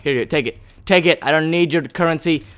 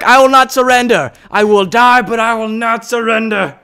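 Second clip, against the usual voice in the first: raised, declaiming battle vow; playful heroic proclamation, pitched up; mock-heroic defiance; 6 semitones higher, 4 dB louder than usual. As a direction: declare a hammed-up last stand. Mock-heroic last stand in game.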